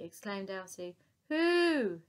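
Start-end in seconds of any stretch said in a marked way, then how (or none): 1.3-2.0 high, loud trumpeting hoot; loud mammoth hoo. Acted. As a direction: sound off like an eager mammoth. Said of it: "hooted the mammoth".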